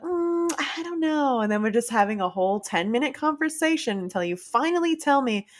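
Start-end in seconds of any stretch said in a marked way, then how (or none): 0.0-1.4 higher, slow, unsure imitation; a mildly hammed-up hesitant answer; hesitant mock reply. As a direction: drag it out like you can't decide. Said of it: "And you're like".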